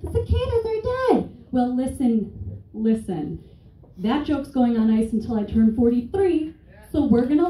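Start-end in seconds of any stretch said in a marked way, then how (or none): 0.0-1.3 high mocking audience voice; mock heckler. Mocking the crowd who doubts the joke, high and smug. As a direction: squeak it like a smug know-it-all. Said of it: "some of you are like".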